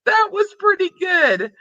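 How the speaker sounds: high-pitched laughter breaking through the words; apologizing to chat while helplessly cracking up, laughing; laughing hard. Real, not acted. Streamer losing it over a viewer's entry, laughing through it.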